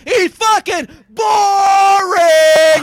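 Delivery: slow, high, loud shout; shouted rant. Real, not acted. Host yelling at a comedian on air, slow shout. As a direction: drag it out and yell it. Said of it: "That was the greatest fucking rant".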